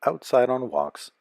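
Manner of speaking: usual speaking voice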